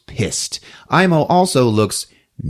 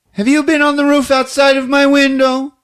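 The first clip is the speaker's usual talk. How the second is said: put-on high, wide-eyed little boy asking; kid voice; 4 dB louder than usual. Kid asking his dad about the roof in a high voice. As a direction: squeak it out like a suspicious child. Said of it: "Pietri is like".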